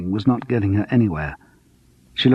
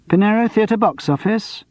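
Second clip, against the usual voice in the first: higher box office phone greeting; 11 semitones higher than usual. Acted. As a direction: answer the phone professionally. Answering theatre phone.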